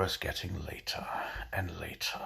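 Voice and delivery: hushed storyteller whisper. Acted. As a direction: whisper it to build suspense. Building suspense in a joke.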